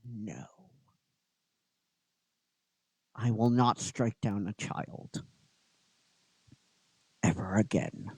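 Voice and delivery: low, quiet resolve; Goldar refusing. Acted. Goldar refuses to strike a child, low and quiet. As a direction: refuse softly with long, heavy pauses. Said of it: "And he goes".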